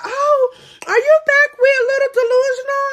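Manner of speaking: put-on high squealing excited girlfriend; excited friend. Carla teasing the storyteller about her ex, squealing high. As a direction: pitch it way up like a thrilled friend. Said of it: "Carla all excited".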